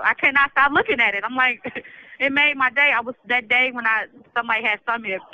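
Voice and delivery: ordinary delivery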